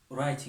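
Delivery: usual speaking voice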